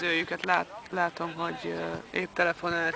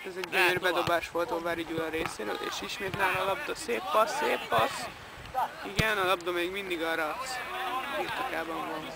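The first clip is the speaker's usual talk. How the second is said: excited hungarian sports commentary; 6 dB quieter than usual. Acted. Mock live match commentary.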